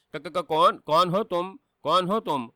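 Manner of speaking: frightened stutter on کون; stammering in terror. A narrator's reading. Amr asks the dark spirit, terrified and stammering. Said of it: "دہشت زدہ نگاہوں سے".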